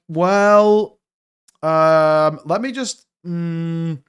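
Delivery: drawn-out fillers, stalling for an answer; mock hesitation, hanging on each sound; hesitant thinker. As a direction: imitate someone slowly groping for words. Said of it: "they're literally trying to think of an answer".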